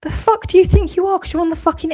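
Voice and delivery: high, loud indignant challenge; real angry outburst. Real, not acted. Ranting at online critics who think they matter, furious. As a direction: flare up in outrage, pitch shooting up.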